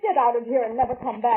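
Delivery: loud, furious order to leave. Acted. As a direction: throw him out of the house, full force. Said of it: "Tried to throw me out of her house".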